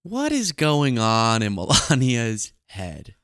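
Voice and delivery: slow, full-voiced musing; drawn-out wondering. Host wondering over a muted clip of Melania, slow and drawn out. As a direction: stretch out the question in full voice.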